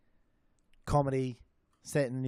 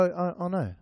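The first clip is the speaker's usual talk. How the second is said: New Zealand accent; 4 semitones higher than usual. Shown as a demonstration. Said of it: "Every vowel is a U".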